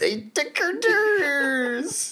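very high, drawn-out cartoon wail; South Park townsfolk. Host echoing the cartoon line, loud high stretched wail. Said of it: "Is that a South Park reference".

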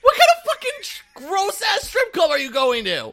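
a high, loud, slowed-down shout of disbelief; outraged shout. Real, not acted. Friend yelling in outrage about the strip club, outraged shout. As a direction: yell it in outrage. Outraged at hand job revelation.

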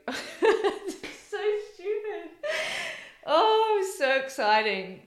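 laughing through happy tears; crying. Real, not acted. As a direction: voice breaking into tearful laughter. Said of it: "she's really crying here".

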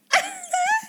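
high squeaky laugh; a short high squeal of laughter. Real, not acted. High squeal of laughter to end the story.

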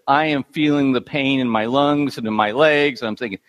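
ordinary delivery

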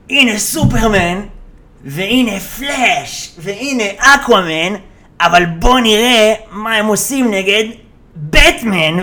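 slow, drawn-out mock grand introduction; stretched-out playful announcer build-up; child presenting toys. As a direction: announce each hero like a pretend showdown. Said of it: "זה כמו שאני".

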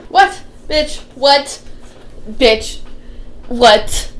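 loud, punchy back-and-forth; hammed-up loud bickering; mock shouting. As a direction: bark the words at each other. Mocking exchange, shouted.

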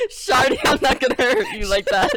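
loud, laughing reassurance; laughing tease. Pretend.

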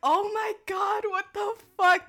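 high, loud, drawn-out exclamation; retold disbelief. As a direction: re-enact stunned disbelief, slow and high. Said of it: "I was all like".

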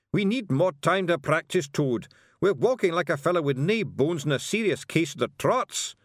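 high, loud wee Scottish fighter; a cheeky little Scots warrior complaining; Scottish Feegle. Acted.

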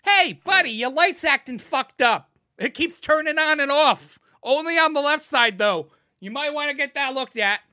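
high, loud mock-helpful jeer; sarcastic loud heckle at another driver; sarcastic taunt. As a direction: taunt a driver about the blinker. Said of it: "It's like".

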